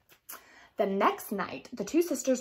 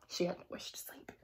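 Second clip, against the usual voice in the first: soft conspiratorial whisper; storyteller's hushed aside; 5 semitones lower, 11 dB quieter than usual. A narrator's reading. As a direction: let the listener in on a secret. Secret aside in narration.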